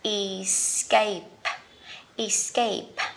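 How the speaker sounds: escape with stress on the second syllable, iss-KAPE; careful English pronunciation. Shown as a demonstration. Teacher modelling escape for learners, deliberate and clear. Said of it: "có nghĩa là".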